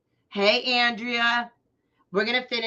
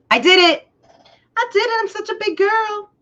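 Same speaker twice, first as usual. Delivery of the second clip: high squealing self-cheer, much higher and louder; excited squeal; 13 semitones higher, 8 dB louder than usual. Real, not acted. Crafter cheering herself for getting the live working, squealing. Proud self-praise after tech success.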